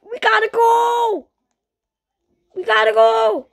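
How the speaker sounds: high, loud rushed yell to flee; urgent shout. Real, not acted. Fleeing the disaster, an urgent high shout. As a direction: shout the escape call.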